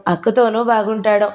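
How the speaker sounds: usual speaking voice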